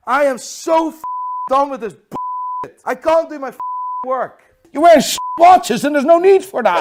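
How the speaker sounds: loud, high, exasperated yelling; furious outburst, voice pitched high; fed-up rant. Real, not acted. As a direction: yell it at the end of your patience. Fed up, ranting.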